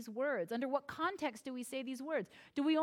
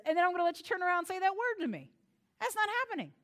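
mock outrage; 7 semitones higher, much faster than usual. Real, not acted. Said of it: "mother's mock outrage".

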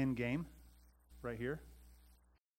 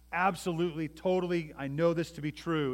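raised, insistent declaration; emphatic certainty; 5 semitones higher, 7 dB louder than usual. Acted. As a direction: insist on it with total conviction.

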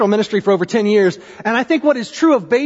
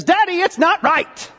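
put-on tearful four-year-old; 8 semitones higher than usual. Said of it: "she turns in tears and says".

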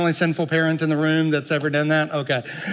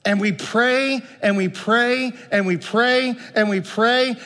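ordinary talk, nothing marked

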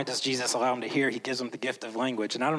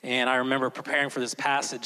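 usual speaking voice

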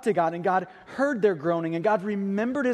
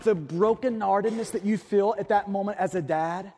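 ordinary talk

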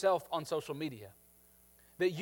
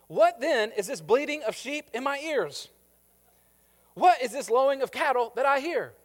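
higher and louder challenging question; indignant Samuel; 7 semitones higher, 6 dB louder than usual. Acted. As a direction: call out the lie with mock wonder. Samuel confronts Saul.

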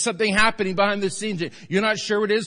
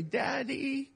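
put-on much higher, soft child's voice; little girl calling Daddy; 8 semitones higher, 8 dB quieter than usual. Father recalling his daughter Sarah calling, high soft child voice. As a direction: call softly like a small girl waking her dad. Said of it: "If I hear little Sarah say".

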